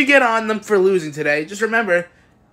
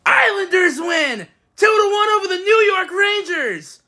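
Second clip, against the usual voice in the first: triumphant announcement; 12 semitones higher than usual. Real, not acted. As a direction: announce the win like an arena announcer. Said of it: "Let me first start off by saying".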